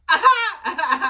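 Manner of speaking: high, loud mock squeal; retold nervous squawk; starstruck "ah". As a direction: let out a flustered fan noise. Retold starstruck reaction to greeting.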